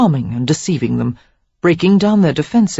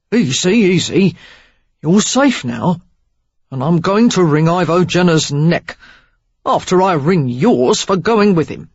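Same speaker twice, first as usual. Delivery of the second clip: lightly voiced man, louder, soothing then gruff; calm her, then growl a threat; Derek calming; 4 dB louder than usual. A narrator's reading.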